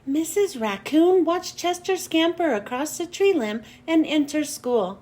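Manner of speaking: ordinary delivery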